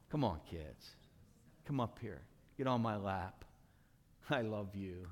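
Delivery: low, soft and gentle invitation; quiet, loving low voice; tender welcome. Acted. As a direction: coax small children close, softly. Jesus tenderly welcoming children, acted.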